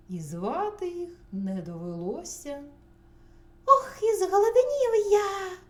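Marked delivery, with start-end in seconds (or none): 3.6-5.6 high, very loud squeaky whine; squeaking mouse. A narrator's reading. First mouse complains of hunger at table, squeaking. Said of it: "пищить Круть".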